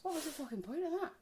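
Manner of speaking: fast, high, exasperated question. Retold or imitated. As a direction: fire it off in annoyance. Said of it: "I thought".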